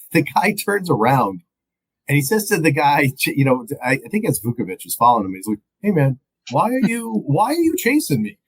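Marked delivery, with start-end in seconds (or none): 5.8-8.4 high-pitched imitation of a baffled runner; comically high, bewildered question; mock bewildered quote. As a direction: play a puzzled guy being chased, pitched up high. Acts out man questioning his pursuer.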